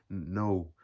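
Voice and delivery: ordinary delivery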